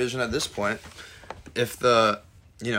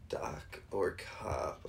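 whispered; 5 semitones lower, 11 dB quieter, much slower than usual. Real, not acted.

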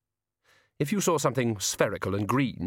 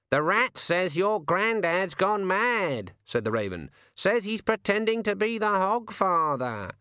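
slow, much higher croaking bird voice; talking raven; 9 semitones higher, slower than usual. A narrator's reading. The raven telling Susan her grandfather's gone mad, high slow bird voice. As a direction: speak like a sardonic raven. Raven relaying rat's message.

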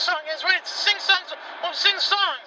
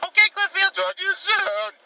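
ordinary talk

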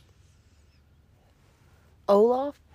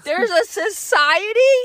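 shocked disbelief; 10 semitones higher, 10 dB louder, slower than usual. Real, not acted.

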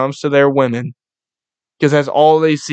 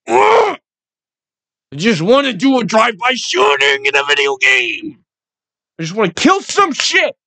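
high-pitched squealing bloodlust; excited mock aggression; 10 semitones higher than usual. Shown as a demonstration. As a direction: squeal it like overloaded, playful aggression. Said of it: "aggressiveness right now".

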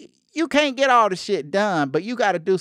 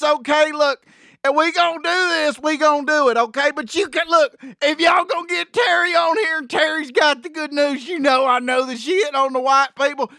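higher, excited backwoods buddy; eager country guy talking fast; Terry voice; 5 semitones higher than usual. Acted. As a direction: keep Terry's giddy higher voice going. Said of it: "It's your buddy Terry".

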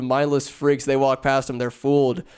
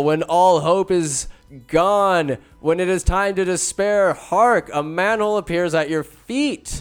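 mock-epic trailer narration; 4 semitones higher than usual. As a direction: build it up like a movie trailer.

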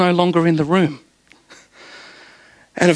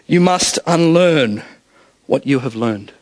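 slow, measured wise-master impression; Yoda; 5 semitones lower, slower than usual. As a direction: draw it out, dropping low at the end. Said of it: "And he says".

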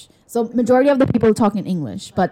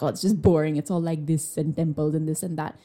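lower, bored mock grumble; bored complaint; 6 semitones lower than usual. Imitating people who find it dull, bored grumble. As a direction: mimic a bored, dismissive grumble.

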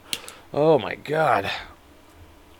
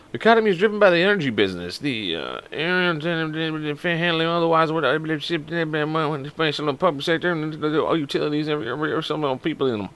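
quiet mumbled reading; 3 semitones higher, 7 dB quieter than usual. Shown as a demonstration. Reads long text, tripping.

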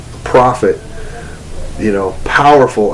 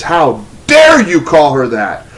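indignant high scolding of God retold; screaming rebuke; 8 semitones higher than usual. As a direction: thunder the rebuke, drawing out key words. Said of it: "is screaming at me and says".

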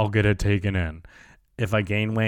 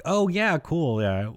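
high overeager "oh", then settling; feigned familiarity; 6 semitones higher, slower than usual. Retold or imitated. Customer hiding he's no real New Yorker, high feigned recognition. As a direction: bluff along, starting high and casual. Bluffs knowing the Brooklyn hospital.

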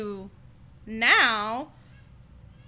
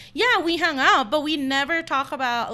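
louder, higher emphatic protest; mock defensive outburst; retold protest; 5 semitones higher, 8 dB louder than usual. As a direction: insist loudly in a retold exchange. Acted protest to a friend.

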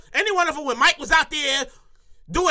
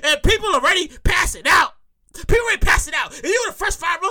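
ordinary delivery

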